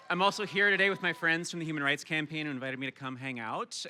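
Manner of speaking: ordinary talk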